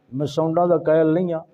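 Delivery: normal talking voice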